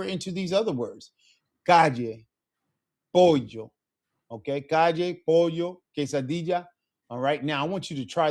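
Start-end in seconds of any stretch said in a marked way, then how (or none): 1.6-6.7 "calle", "pollo" and "quesadilla" with a y sound for the ll; double L as y. Shown as a demonstration. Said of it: "double L's in Spanish will make a Y sound".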